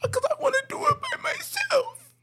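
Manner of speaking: high, mock-tearful whine; hammed-up sobbing coworker voice; mock crying. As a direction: imitate her crying, squeaky and high. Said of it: "She's like".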